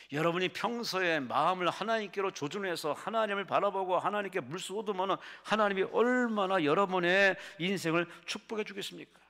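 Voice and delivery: high-pitched, swelling sermon delivery; impassioned preaching. Acted. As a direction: drive the story home from the pulpit. Preaches to the congregation. (높은 톤으로 힘주어 설교하듯)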